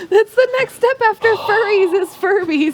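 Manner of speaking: laughing. Real, not acted. Laughing joke.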